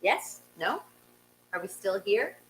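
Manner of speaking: usual speaking voice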